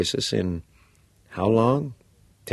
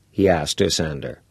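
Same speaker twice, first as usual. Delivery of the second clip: usual speaking voice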